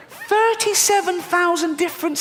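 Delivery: Thirty-seven thousand said high and squeaky, aghast; squeaky repeat. Shown as a demonstration. Comedian repeating the spider count, high squeal. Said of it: "repeated take".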